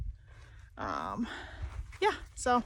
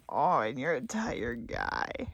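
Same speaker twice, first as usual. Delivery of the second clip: tender cooing; 10 semitones lower, 4 dB quieter, slower than usual. Real, not acted. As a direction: coo gently to a sleepy dog. Cooing to tired dog.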